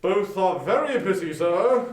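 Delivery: lightly voiced charity gentleman; polite portly gentleman. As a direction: greet him with formal politeness. Gentleman answers.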